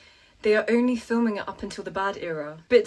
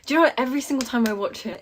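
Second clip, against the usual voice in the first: ordinary delivery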